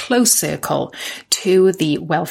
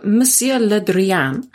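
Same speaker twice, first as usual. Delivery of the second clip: French accent; slower than usual. Shown as a demonstration. Repeats the name in her French accent.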